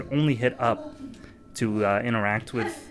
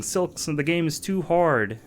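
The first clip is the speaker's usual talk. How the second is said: higher-pitched mock whine of a crying anime girl; 7 semitones higher than usual. Streamer quoting a meme's crying anime girl, mock crying. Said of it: "anime girl crying and saying".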